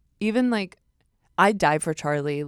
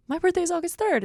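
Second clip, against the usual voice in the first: high chirpy reply; 13 semitones higher, 4 dB louder than usual. Retold or imitated. To Charlie about their birthdays, high and eager. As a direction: jump way up high, bright and eager. Retelling meeting Charlie by the pool.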